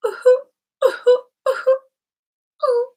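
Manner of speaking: high, hooting fake sobs; hammed-up high whimpering sobs; mock dainty crying. Shown as a demonstration. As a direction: ham up a pretty little cry, way up high. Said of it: "I'm not just crying like that".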